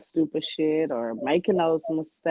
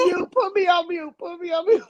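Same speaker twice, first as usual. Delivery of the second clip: laughing; 10 semitones higher than usual. Real, not acted. Still laughing, live.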